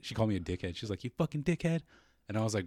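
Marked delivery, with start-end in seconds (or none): 1.0-1.8 high-pitched imitation of a cursing woman; hammed-up high voice; mock angry neighbour. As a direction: mimic her swearing back, pitched way up. Imitating neighbour girl's insult.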